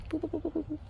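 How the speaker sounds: high, soft beeping boops; GPS blip sounds. Pretend. Coach mimics a GPS dot blipping softly. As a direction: chirp like a moving map dot.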